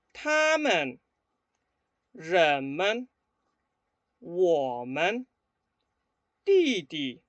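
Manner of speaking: 跟我读的发音示范; repeat-after-me drill. Shown as a demonstration. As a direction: model each word for students to echo. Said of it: "escuchen y repitan después de mí".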